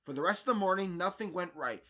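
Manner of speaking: ordinary delivery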